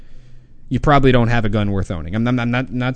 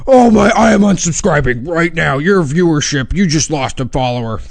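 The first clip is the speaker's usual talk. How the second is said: angry viewer; 3 semitones higher, 4 dB louder than usual. Retold or imitated. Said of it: "there's that guy right now, it's like".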